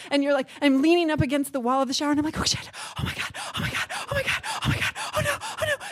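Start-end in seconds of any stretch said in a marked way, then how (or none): 2.4-5.8 breathy, soft whimpers rising high; whimpering dread. Retold or imitated. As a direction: whimper as the next contraction looms. Dreading next contraction in shower.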